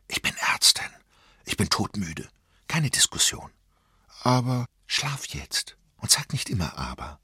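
tonlos geflüsterte Zurechtweisung; flüstere bestimmt, ohne Diskussion zuzulassen; abschließendes Flüstern. A narrator's reading. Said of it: "flüsterte sie, so ein abschließendes Flüstern". (müde Mutter schickt ihr Kind ins Bett, flüsternd)

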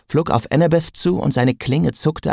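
ordinary delivery, nothing marked

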